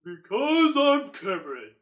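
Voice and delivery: slow, pushed-down deep voice. Shown as a demonstration. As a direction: drag it out low and booming. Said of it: "put more bass into it".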